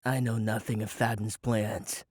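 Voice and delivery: low, slow, steady calm; frighteningly even. A narrator's reading. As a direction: deny it with icy calm. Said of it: "Eric's voice was frighteningly even".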